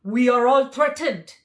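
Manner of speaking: loud tiger voice; the tiger, raising the alarm; tiger's voice. A narrator's reading. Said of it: "answered the tiger".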